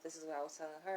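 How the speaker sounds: normal talking voice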